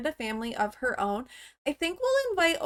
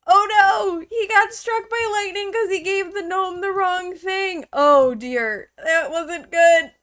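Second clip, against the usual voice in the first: loud dismay; 6 semitones higher, 8 dB louder than usual. Real, not acted. Sim struck by lightning.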